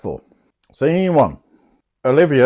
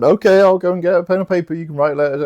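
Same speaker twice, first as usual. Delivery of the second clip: tag; 8 semitones higher, 3 dB louder, faster than usual. Retold or imitated. Voicing Fester switching back.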